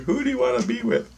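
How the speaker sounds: high, quick mimicking voice; imitated questioners. Dream guides questioning the dreamer, high quick mimicry. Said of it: "they ask me these questions".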